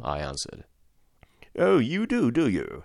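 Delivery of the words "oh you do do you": sneering rise on oh, then a snarl. A narrator's reading. Dismissed butler taunts the son, snarling. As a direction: spit it back with contempt. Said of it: "he snarls".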